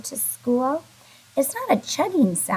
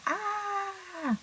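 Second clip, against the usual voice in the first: high, breathy, soft gasp of fright; scared ah; 9 semitones higher, 10 dB quieter than usual. Shown as a demonstration. Teacher demonstrating a frightened ah for kindergartners. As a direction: gasp ah like something scared you. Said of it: "like I'm scared of something".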